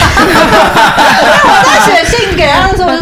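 laughing. Real, not acted. Laughing while telling.

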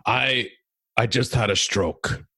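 put-on lightly gravelly old man, slow delivery; a hint of old-man gravel, drawn out; Remy's gravelly voice. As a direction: imitate an elderly comic slowly landing a line. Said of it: "he talks in a really gravelly voice".

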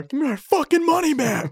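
high mock angry patron. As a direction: whine it like a ripped-off customer. Imitating angry audience member.

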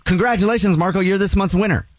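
high, bright winner announcement; excited congratulations. Acted. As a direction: cheer it like a game-show host. Announces the prize win.

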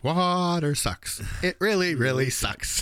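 a hint of a sulking boy; quoting Bobby. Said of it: "the other part is".

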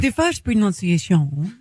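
slow, mock British accent; mimicking British narrator. Co-host teasing about the clip, mock British accent. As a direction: imitate the clip's British voice, drawn out. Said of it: "'cause that's how he says it".

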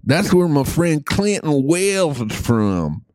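lightly put-on Alabama accent, playful; Southern accent. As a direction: talk like a good old boy from Alabama. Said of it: "when you talk like this".

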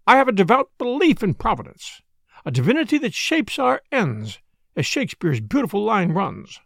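high, lilting declaration; fussy matron, pious. Acted. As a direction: proclaim it with self-important piety.

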